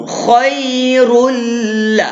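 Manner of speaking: khairul drawn out with a clear l ending; khairul modelled. Shown as a demonstration. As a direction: show the class khairul slowly. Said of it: "Nak bagi tahu bunyi dia macam tu". (tunjuk sebutan khairul dengan l yang jelas)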